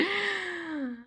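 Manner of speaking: sharp, high mock gasp; a dramatic intake of breath; exasperated gasp. Alone in the empty lab, a dramatic gasp.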